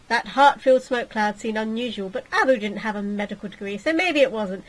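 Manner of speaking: ordinary talk, nothing marked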